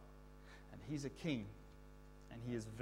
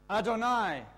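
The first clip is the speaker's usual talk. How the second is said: loud, higher call of the cue word; 8 semitones higher, 10 dB louder than usual. Shown as a demonstration. Teacher calling the cue to the class, loud call. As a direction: call it out so they answer. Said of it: "when I say the word Adonai".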